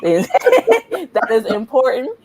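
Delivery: amused insistence, broken by laughing. Real, not acted. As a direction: laugh while making your point. Laughs while explaining the spelling.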